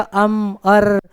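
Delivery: drawn-out hesitation fillers; halting non-fluency. Shown as a demonstration. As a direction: mimic a weak speaker groping for words. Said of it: "जो थोड़ा बहुत कमज़ोर है non फ्लुए". (अटक-अटक कर बोलने की नकल)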